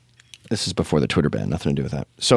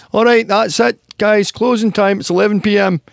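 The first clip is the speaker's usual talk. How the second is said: high, loud mock Glaswegian closing call; Glaswegian barman; 12 semitones higher, 4 dB louder than usual. Barman calling closing time, loud mock Glaswegian. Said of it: "like a Glaswegian pub".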